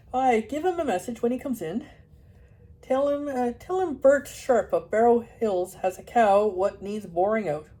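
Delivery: loud, broad Yorkshire farmer. Acted. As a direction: speak like a rough Yorkshire dalesman. Farmer Bert Sharp talks.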